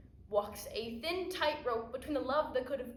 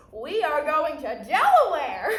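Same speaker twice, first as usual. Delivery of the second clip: big, loud, amused proclamation; showman's announcement; 3 semitones higher, 10 dB louder than usual. Acted. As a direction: proclaim the trip like a grand destination, with a laugh.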